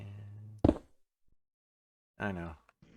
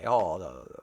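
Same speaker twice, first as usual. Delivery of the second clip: normal talking voice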